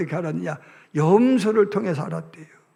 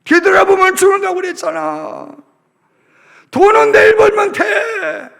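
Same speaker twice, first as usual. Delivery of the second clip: high, loud urgent yelling; pleading shout; 11 semitones higher, 8 dB louder than usual. Acted. Husband yelling at his wife leaving Sodom. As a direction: yell to stop her turning back.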